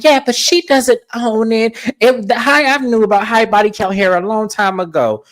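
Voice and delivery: high-pitched mock of a castmate butting in; imitating Heather. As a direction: squeak it out like a know-it-all. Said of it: "And Heather was like".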